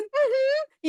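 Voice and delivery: very high, long falling mm-hmm; emphatic agreement. Retold or imitated. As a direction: squeak out a knowing mm-hmm.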